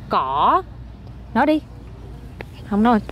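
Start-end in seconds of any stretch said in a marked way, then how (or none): 0.1-0.6 "cỏ" low, then swooping up; pronunciation demo. Shown as a demonstration. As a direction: model "cỏ" with a long dipping tone. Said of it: "con nói nè". (đọc mẫu "cỏ", xuống thấp rồi lên)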